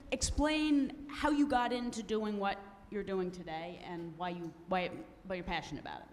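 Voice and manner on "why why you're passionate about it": normal talking voice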